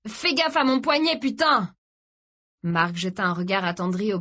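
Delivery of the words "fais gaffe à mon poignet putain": high, angry yelp of pain; pained protest. A narrator's reading. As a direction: swear at him through the pain. Blessé qui proteste. (protestation aiguë et furieuse)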